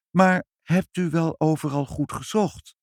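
high, sweetly friendly question. A narrator's reading. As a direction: ask kindly, pitched well up. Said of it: "vroeg moeder vriendelijk".

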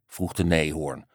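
ordinary delivery, nothing marked

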